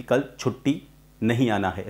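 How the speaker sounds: "कल छुट्टी", clear gap, "नहीं आना है"; pause after छुट्टी. Shown as a demonstration. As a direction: stop after छुट्टी, then finish the phrase. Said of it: "छुट्टी के बाद कॉमा है". ("छुट्टी" के बाद विराम)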